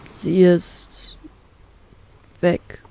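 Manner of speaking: understated choked-up mumble of the uncle; mumbled, choked. Acted. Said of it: "nuschelt mein Onkel mit zusammengeschnürter Kehle".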